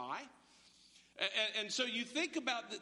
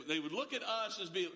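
usual speaking voice

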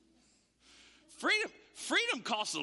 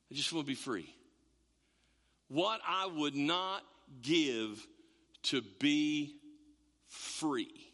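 wistful longing; 8 semitones lower, slower than usual. Retold or imitated. Imagined slave's longing.

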